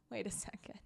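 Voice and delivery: whispering. Real, not acted. Reacting to a snort.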